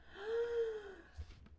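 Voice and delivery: high, drawn gasp; distressed gasp. Real, not acted. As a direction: gasp in dismay at what you heard. Upset by the song.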